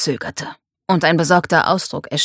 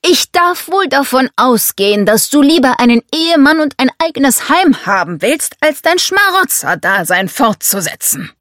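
louder, higher, emphatic rebuke; domineering father, cutting; 8 semitones higher, 5 dB louder than usual. Acted. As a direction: put the daughter firmly in her place.

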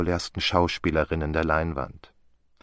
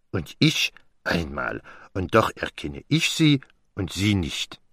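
Poirot. Acted. Besting his friend at recognising the star, as a smug Poirot. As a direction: make each contrast count, in a French accent.

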